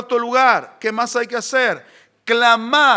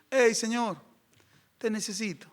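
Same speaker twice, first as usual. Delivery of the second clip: hushed, offhand little call to God; soft half-hearted prayer, trailing away; mock casual plea; 10 dB quieter than usual. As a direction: say it softly, like a timid request. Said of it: "una actitud de desesperación".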